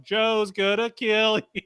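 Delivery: high, drawn-out mock heckle; retold yelling. Fan behind the horrified teens, mock yelling. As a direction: imitate your own gleeful yell without full volume. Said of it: "standing behind him yelling".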